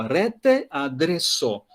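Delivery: "rete adreso" said very slowly and carefully; teacher models the Esperanto word for students, very slow; slow demo word. Shown as a demonstration. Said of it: "é uma palavra só".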